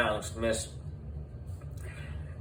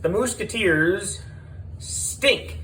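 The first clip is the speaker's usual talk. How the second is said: taunting shout; 9 semitones higher than usual. A narrator's reading. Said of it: "shouted Miss Laney".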